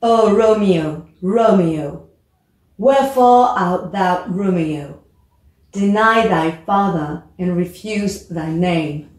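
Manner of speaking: slow dramatic reading of Juliet's lines; teacher models Juliet's balcony speech, slow and expressive. Shown as a demonstration. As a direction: read the balcony scene with feeling. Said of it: "je vais essayer de le prononcer".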